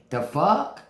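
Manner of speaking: ordinary talk, nothing marked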